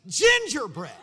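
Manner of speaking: high, very loud retold outburst; incredulous shout. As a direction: shout the thought in comic disbelief. Retold thought, shouted in disbelief.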